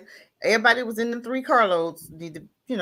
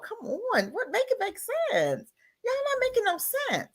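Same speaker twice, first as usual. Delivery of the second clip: exasperated disbelief; 12 semitones higher, 5 dB quieter than usual. Real, not acted. Exasperated at political text.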